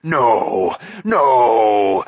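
loud, wailing creature cry; whimpering protest. A narrator's reading. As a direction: plead in a howling whimper. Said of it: "Gurgi protested, whimpering".

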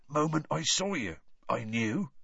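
rough, husky Hagrid. A narrator's reading. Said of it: "in an oddly husky voice".

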